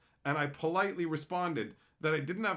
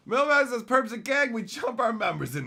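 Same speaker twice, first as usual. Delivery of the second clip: loud, high announcing voice; raised, boisterous read-out; hyped read-out; 7 semitones higher, 8 dB louder than usual. Real, not acted. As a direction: proclaim the chat message to the audience. Reading chat message aloud, hyped.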